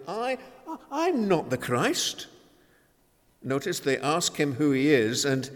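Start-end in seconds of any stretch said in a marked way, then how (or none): 0.1-2.3 "I" lifted high and held, then "I'm not the Christ" firmly; a firm, pointed "I, I'm not the Christ"; emphatic denial. Retold or imitated. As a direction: insist on the "I". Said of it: "answers them literally and emphatically".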